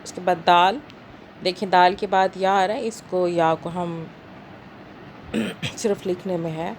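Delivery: usual speaking voice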